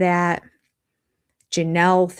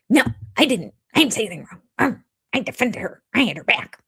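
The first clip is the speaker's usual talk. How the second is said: quick bursts of yappy, indignant protest; mock an angry woman as a yapping little dog; 5 semitones higher, faster than usual. Host mocking Robin's angry defense, like a yappy dog. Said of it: "spouting out like a yappy dog".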